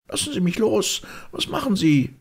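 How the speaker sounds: high-pitched indignant screech. A narrator's reading. Susan, dragged in by Holmes, screeching. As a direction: screech in protest at being grabbed. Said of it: "kreischte sie".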